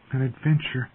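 high, softened echo; wistful. A narrator's reading. Bran echoing his brother's promise, wistful. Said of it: "Bran repeated wistfully".

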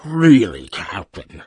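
drawn-out creature voice, loud first word; talking alien wub. Acted. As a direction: reproach the captain as a dignified beast.

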